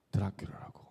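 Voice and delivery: hushed, breathy whisper of the insult; whispered taunt. Retold or imitated. Pastor recalling the bullies' names for him, whispered. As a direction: let the old insult slip out in a whisper.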